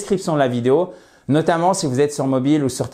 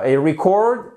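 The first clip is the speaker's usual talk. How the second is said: "record" said ree-CORD; careful "record", weight on CORD; stress on second syllable. Shown as a demonstration. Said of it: "le mot record que vous avez ici". (« record » prononcé ri-CORD, accent sur la deuxième syllabe)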